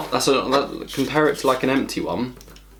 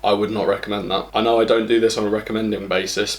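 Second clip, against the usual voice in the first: ordinary talk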